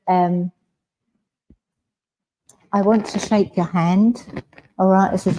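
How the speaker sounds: usual speaking voice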